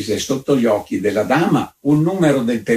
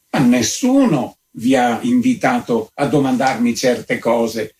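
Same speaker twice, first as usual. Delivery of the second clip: plain reading voice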